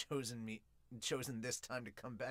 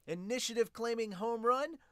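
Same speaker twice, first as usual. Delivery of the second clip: usual speaking voice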